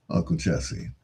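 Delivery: usual speaking voice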